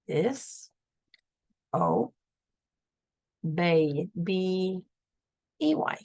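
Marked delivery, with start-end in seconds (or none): 0.1-4.1 "Dis-, o-, bay," split into slow, separate syllables; "Dis-, o-, bay," broken apart for a reader. Shown as a demonstration. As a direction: sound out "Dis-, o-, bay," one beat at a time. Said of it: "sounding out disobey".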